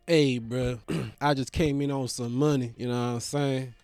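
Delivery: low, soft, laid-back hustler voice; a friend drops his voice to pitch a money deal; imitating the caller.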